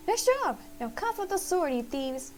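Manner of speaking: hammed-up squeaky mouse triumph; excited mouse. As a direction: cheer the heroes, then order the thieves. Mouse cheers, then demands sword.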